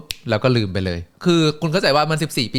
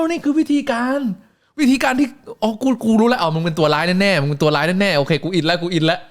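ordinary talk, nothing marked

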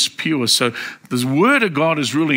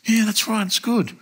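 lightly voiced friend, higher pitched; imitated agreeing friend; 7 semitones higher than usual. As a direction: imitate someone conceding the point in a higher voice. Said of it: "So he was saying to me".

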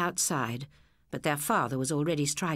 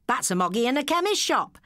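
cheeky schoolboy; 9 semitones higher, 3 dB louder than usual. A narrator's reading. Hughie's joke.

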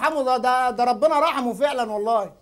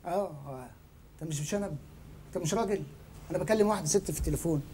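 low, much softer backpedalling; meek excuse; 8 semitones lower, 12 dB quieter, faster than usual. Acted. Excusing himself to an angry challenger, meek and low. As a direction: explain yourself small and hushed.

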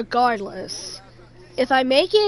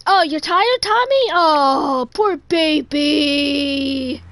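exaggerated fake sympathy, stretching the last word; mock pity; much slower than usual. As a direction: coo sarcastically as if to a baby. Mock pity for game character.

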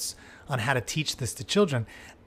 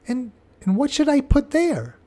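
put-on high, louder puzzled child's voice; a small child asking the teacher; 11 semitones higher, 6 dB louder than usual. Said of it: "It's the child speaking".

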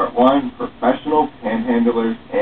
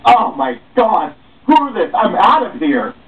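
high, loud storming-off yell; high indignant shout; angry outburst; 11 semitones higher, 3 dB louder than usual. Acted. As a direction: blow up and storm away. Passerby storms off upset.

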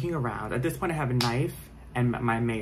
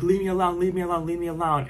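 panicked pleading; 6 semitones higher, 4 dB louder than usual. Retold or imitated. Intruder staring through window.

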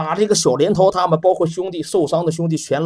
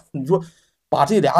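ordinary delivery

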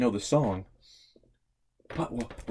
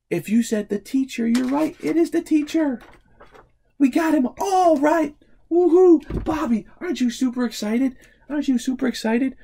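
much higher, louder eager voice; excited; 13 semitones higher, 9 dB louder than usual. Real, not acted. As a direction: hype up Bobby. Cheering with child Bobby.

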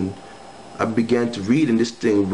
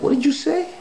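much higher, startled what did you say; shocked. Retold or imitated. Hearing the girl threaten to kill him, a sudden high startled question. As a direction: jolt up high in disbelief.

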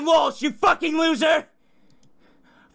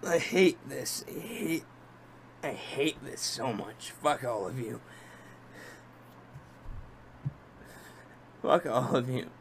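very soft, low, spent voice; defeated; 14 semitones lower, 14 dB quieter than usual. Real, not acted. Streamer who can't do it anymore, quiet and defeated. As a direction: curse quietly, with nothing left.

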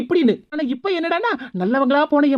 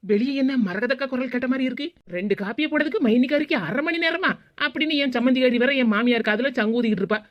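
ordinary talk, nothing marked

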